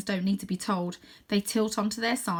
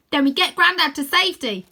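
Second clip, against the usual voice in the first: firm call; 7 semitones higher, 8 dB louder than usual. Acted. Rose answers decisively.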